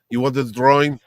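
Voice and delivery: put-on thick-accented contempt, sinking low; Esad Ribic impression. Artist handing a fan his drawing, in a thick scornful accent. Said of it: "That's, that's an uncanny impression".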